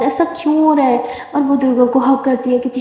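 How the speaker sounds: ordinary delivery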